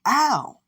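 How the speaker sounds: high, loud "ow"; loud yelp. Acted. Fighter screeched at by the Nomu, yelping high. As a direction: yelp as if the noise hurts.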